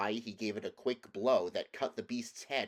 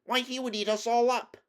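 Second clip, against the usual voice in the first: very high, loud squeal of alarm; alarmed mouse queen; 14 semitones higher, 8 dB louder than usual. A narrator's reading. Mouse queen fears being eaten by the lion, high alarmed squeal. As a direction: squeak it out in panic.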